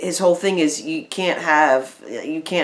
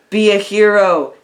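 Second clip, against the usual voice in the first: high, loud, drawn-out call; mock heavenly voice; 5 semitones higher, 6 dB louder than usual. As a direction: imitate a grand voice calling from beyond. Acted voice heard from heaven.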